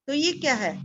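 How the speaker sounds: ordinary talk, nothing marked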